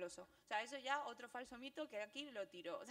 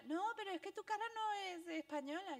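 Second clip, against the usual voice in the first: a higher, louder stranger insisting; insistent stranger; 7 semitones higher, 5 dB louder than usual. Retold or imitated. A stranger doubting that she's Spanish, insisting. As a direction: push back like a doubtful stranger.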